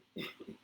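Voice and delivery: brief chuckle at own joke; a quick, breathy little laugh; short laugh. Real, not acted. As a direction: let out a small self-mocking chuckle. Laughs at own sarcastic remark.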